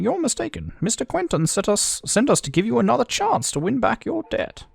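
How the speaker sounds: high-pitched character voice; high, theatrical game dialogue. Acted.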